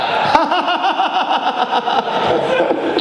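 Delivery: high, long burst of real laughter. Real, not acted. Recounts meeting same interviewer again.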